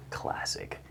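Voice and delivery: a dry, breathless whisper; softly whispered punchline; whispered aside. Acted. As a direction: murmur it as a smug aside.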